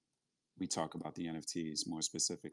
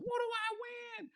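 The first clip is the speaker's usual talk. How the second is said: high mock voice. Voicing an eager participant's question.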